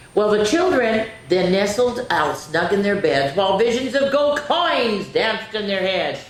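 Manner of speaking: put-on bright, animated read-aloud for kids; story narration. A narrator's reading. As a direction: read it like a bedtime story to children.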